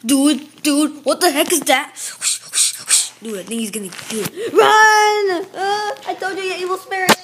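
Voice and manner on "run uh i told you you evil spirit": mock panic. Fleeing an evil spirit with friends, panicked cry. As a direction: cry out high in pretend terror.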